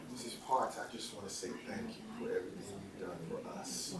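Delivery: very soft, low and overcome, retold; hushed, low retelling of tearful gratitude; retold tearful thanks. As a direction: re-enact a choked-up thank you, barely audible. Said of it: "I started crying. I couldn't control myself".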